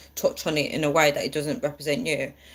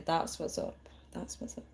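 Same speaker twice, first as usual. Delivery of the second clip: soft, hushed American imitation of That's what's up; a hint of a chilled American; American accent; 13 dB quieter than usual. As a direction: murmur That's what's up in a laid-back American way. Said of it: "As Americans would say".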